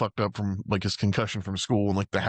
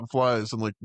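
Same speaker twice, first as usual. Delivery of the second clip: ordinary talk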